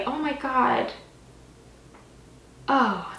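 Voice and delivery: normal talking voice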